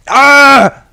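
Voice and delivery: ordinary delivery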